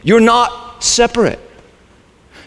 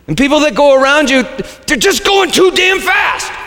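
a hint of road rage, pitch jumping up; mock annoyed driver; 8 semitones higher than usual. Preacher mocking a driver's road rage. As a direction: grumble at the other drivers, rising to a yelp.

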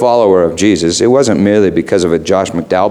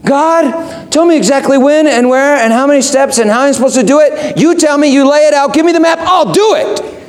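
demanding prayer. Retold or imitated. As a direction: insist on the map like a whiny child. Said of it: "That's what we want".

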